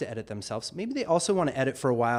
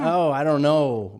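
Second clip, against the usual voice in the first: put-on South Carolina accent; 3 semitones higher, 7 dB louder, slower than usual. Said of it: "Oh, the South Carolina accent".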